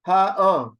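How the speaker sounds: ha, uh as two separate loud syllables; loud teacher's demo of split ha, uh; wrong reading. Shown as a demonstration. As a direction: punch out ha, then uh, as regular vowels. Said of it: "you do not read this as".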